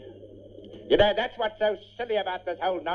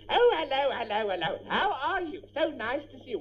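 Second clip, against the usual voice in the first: high, singsong mimicry of greeters; mock their gushing, parrot-like hellos; 6 semitones higher than usual. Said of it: "you say it like parrots".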